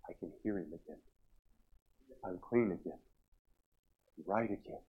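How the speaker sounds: retold softly, with long pauses; hushed relief. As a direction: let each short phrase land gently. Retelling her relieved parting words.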